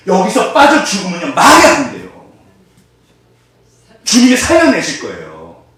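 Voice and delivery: high, loud insistent declaration; emphatic assurance. Real, not acted. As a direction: drive it home to the congregation. Preacher insists forcefully. (높고 크게 힘주어 확신하는 설교)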